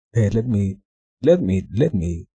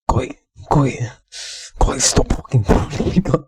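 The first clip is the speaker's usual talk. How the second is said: raised, exasperated scolding of a friend; exasperated plea; 4 semitones higher, 4 dB louder than usual. Real, not acted. Streamer telling Cody to stop trolling, exasperated plea. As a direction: plead with them, pitch lifted.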